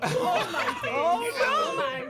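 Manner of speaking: slow words through laughter; laughing. Real, not acted. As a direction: gasp it out while cracking up. Cracks up at pickup line.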